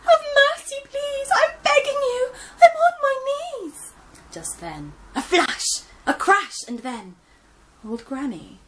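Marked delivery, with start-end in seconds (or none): 0.1-3.9 very high, loud, tearful pleading; begging for mercy. Acted. Gran begging the piglets for mercy, crying. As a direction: plead on your knees, sobbing.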